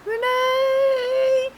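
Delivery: high, long drawn-out call of the name; imitated holler. Cat calling the name from the porch, long high holler. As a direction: call the name like the sister would. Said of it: "I'd hear it again".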